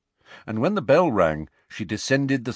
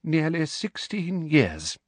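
resentful; 8 semitones higher, slower than usual. Acted. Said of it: "in a deeply resentful tone".

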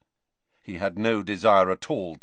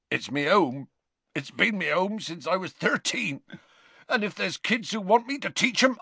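tearful Hagrid; 10 semitones higher than usual. A narrator's reading. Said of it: "fat tears still leaking out".

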